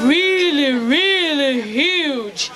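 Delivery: "really huge" drawn out very slowly; emphatic stretch. Acted. Telling kids how huge elephants are, slow emphatic stretch.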